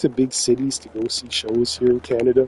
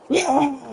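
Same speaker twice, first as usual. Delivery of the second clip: sudden high mock scream of a crowd guy; retold scream; 13 semitones higher than usual. Fan at the show screaming as the band starts, mock high yell. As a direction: yelp it out like the headbanger losing it. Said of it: "He's screaming his head off".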